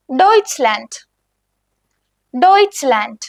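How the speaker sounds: Deutschland as "doytch-lant"; German pronunciation. Shown as a demonstration. Teacher models Deutschland for beginners. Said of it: "ഡോയ്ച്ച്ലാൻഡ് എന്ന് പറയുന്നത്".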